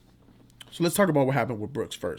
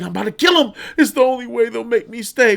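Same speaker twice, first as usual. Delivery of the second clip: higher, louder mock menace; hammed-up would-be killer; mock inmate; 7 semitones higher, 5 dB louder than usual. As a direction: play the crazed inmate half-joking. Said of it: "He's like".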